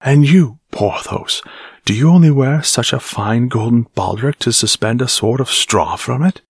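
mock the golden baldric with scorn; scolding sarcasm. Captain scolding Porthos.

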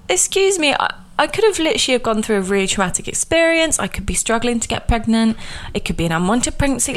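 high, offended "excuse me"; imagined indignant retort. Pretend. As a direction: lecture the stranger in mock outrage.

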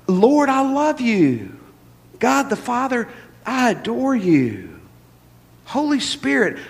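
tender prayer. Shown as a demonstration. Preacher shows the congregation how to praise, slow and tender. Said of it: "Let me just show you".

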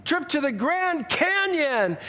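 high, sweeping prize reveal; game-show announcer. Retold or imitated. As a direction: sell the trip like a TV host. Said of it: "Jay would go on".

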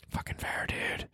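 unvoiced whispered aside. Real, not acted. Host conceding to himself in a whisper. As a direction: breathe it, no voice.

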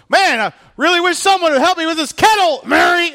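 high, loud sarcastic shout; passive-aggressive Martha. Acted. Martha yelling pointed hint at sitting Mary, loud and high.

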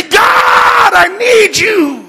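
very high, long-held shout to God; crying out. Acted. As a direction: stretch it into a huge pleading cry. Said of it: "He cried out, church".

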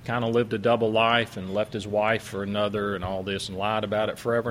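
usual speaking voice

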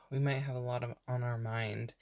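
unhurried meditation voice with long pauses; guided meditation. Real, not acted. Podcast host leading burned-out listeners into breathing, calm meditation voice. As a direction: softly guide them into rest, leaving space to breathe.

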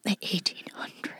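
hushed, unvoiced mock-mysterious whisper; breath-only playful echo; spooky whisper. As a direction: whisper it like a ghost story.

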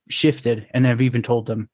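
usual speaking voice

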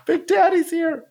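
very high hammed-up panic; mock scared. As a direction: squeal the warning in play fright. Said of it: "I was like".

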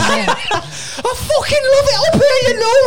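very high, slow mock Scouse; hammed-up high northern squeal, Scouse to Mancunian; Scouse-Mancunian accent. Shown as a demonstration. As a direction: wail it in wandering Scouse-Mancunian. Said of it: "It sort of goes from Scouse into Mancunian".

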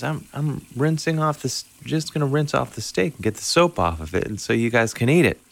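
high breezy mom voice; matter-of-fact and chipper. Retold or imitated. Mom explaining to dad she'll rinse the steak, chipper.

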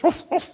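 high, loud imitated dog bark. Dog answers butcher in joke.

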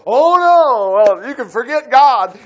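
loud, high mock panic; mock panicked Israelite. Preacher voicing frightened Israelites in loud mock panic. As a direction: ham up the frightened crowd giving up. Mocking the scared spies' voice.

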